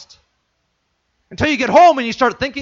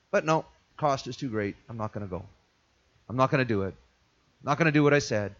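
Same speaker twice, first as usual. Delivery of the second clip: much lower, much softer backing out; low reluctant refusal; 7 semitones lower, 10 dB quieter, faster than usual. Retold or imitated. Son backing out of his promise, low and soft. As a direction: mutter the refusal like giving up.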